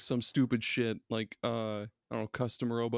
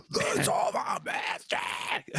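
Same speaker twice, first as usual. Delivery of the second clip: guttural growl; 3 semitones higher, much slower than usual. Retold or imitated. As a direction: growl it like grindcore. Imitating a guttural metal vocal.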